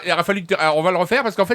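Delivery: normal talking voice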